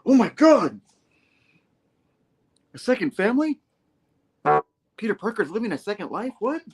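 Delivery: high-pitched comic disbelief; hammed-up incredulous outburst; mock shock. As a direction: squeak it, scandalized by the accusation. Acts out Peter's shock at accusation.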